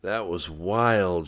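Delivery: slow, drawn-out dry-throated words; groggy words from a dry throat. A narrator's reading. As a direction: draw it out through a parched throat. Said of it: "said through a dry throat".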